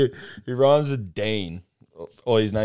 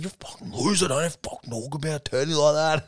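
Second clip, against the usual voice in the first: higher, mock-furious rant but softer; retold losing cool; 6 semitones higher, 5 dB quieter than usual. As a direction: replay the blow-up, pitch up, no volume. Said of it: "I just lost my cool at him".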